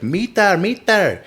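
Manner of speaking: high-pitched imitation Thai accent; mock Thai accent. Imitating an Australian video maker saying it, mock Thai accent. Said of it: "saying it with the Thai, with the Thai, um, accent".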